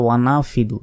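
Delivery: "নাফিদু" with the h left out entirely; হা বাদ দেওয়া. Shown as a demonstration. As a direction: go straight from "না" to "ফিদু". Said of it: "হার উচ্চারণই করে না". ("নাফিদু" হা উচ্চারণ না করে)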